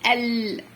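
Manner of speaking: ال halted on a held ل; teacher resting on the closed ل in ال; braked stop. Shown as a demonstration. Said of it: "السكون زي الفرامل".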